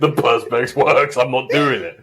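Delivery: slow, stubborn, dismissive quote; stubborn neighbour voice. Retold or imitated.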